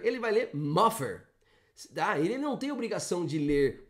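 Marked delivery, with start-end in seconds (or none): 0.0-1.2 "muffer" with an F sound in place of the TH; wrong F sound. Retold or imitated. Teacher mimics a student's wrong TH as F. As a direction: mimic a learner saying "muffer" with F. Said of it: "não gosto de dizer ... que o T H tem som de F".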